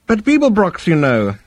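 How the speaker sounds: high-pitched, smooth professional voice; musing psychiatrist. Acted. Psychiatrist discussing a profitable client, musing. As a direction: play the therapist weighing a thought.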